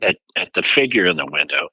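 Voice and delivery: ordinary delivery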